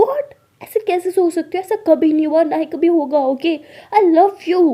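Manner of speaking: high, loud, insistent; indignant disbelief. Acted. Alia swears her love to a doubting partner, indignant and loud. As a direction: protest your love forcefully. Shocked reassurance in quarrel.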